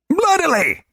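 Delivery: very high, loud gleeful cry; gleeful little man. Acted. Globin gleefully adding to his warning, high and loud. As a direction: crow the word.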